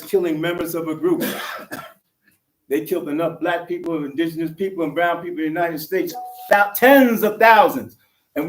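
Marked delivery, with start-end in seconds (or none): 6.5-8.0 emphatic slow shout. Real, not acted. Rally speaker hammering the death toll.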